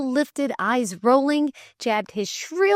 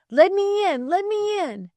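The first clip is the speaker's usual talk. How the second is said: high urgent plea at the door; begging to be let inside, fast; urgent pleading; 12 semitones higher than usual. Acted. Lay reader begs at the door.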